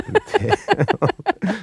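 high, drawn-out coo; voicing the dog. Dog dressed as tail.

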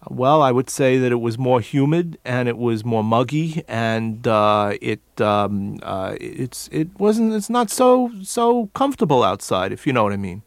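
thick New York speech; New York accent. Shown as a demonstration. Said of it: "how did you used to speak?".

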